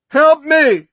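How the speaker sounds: very high, loud cry for help; a shrill panicked plea; terrified cry. Acted. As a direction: scream for help. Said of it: "Norton cried".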